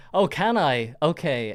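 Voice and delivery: usual speaking voice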